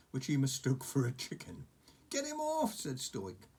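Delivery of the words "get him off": much higher, urgent plea; alarmed chief. Acted. Stoik with a dragon on his beard, high and urgent. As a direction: get the beast off me.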